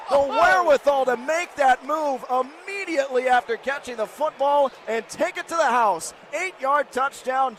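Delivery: high, loud play-by-play eruption; live football commentary at full excitement; excited touchdown call. Real, not acted. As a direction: belt out the score over the crowd.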